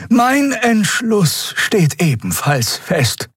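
put-on slow, high old woman's voice; old wise Xia. Old Xia telling the crew her decision, slow aged voice. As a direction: take your time like an elder.

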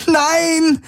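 very high desperate scream. A narrator's reading. Kirai refuses to be taken away, high scream. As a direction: scream against the arrest. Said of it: "schrie Kirai".

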